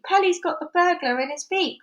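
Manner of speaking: high, loud gleeful shout; excited cry. A narrator's reading. Monkey tells everyone of the catch, high and excited. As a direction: announce it in delight. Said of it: "cried the monkey".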